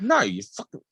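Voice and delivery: high-pitched retold outburst; annoyed dad. Dad after throwing the crisps away, annoyed.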